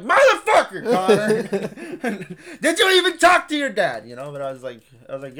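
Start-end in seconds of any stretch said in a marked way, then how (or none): 0.0-4.1 high, loud, laughing outburst at a friend; mock-furious shout that breaks into laughter; exasperated yell. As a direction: yell it in disbelief, cracking up. Retold outburst at friend Connor.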